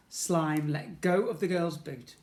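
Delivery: ordinary delivery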